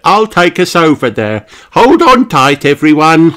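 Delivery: high, loud boat-driver's call; rally the passengers, pitched high; eager Miss Rabbit. A narrator's reading. Miss Rabbit to her passengers before zooming off, eager.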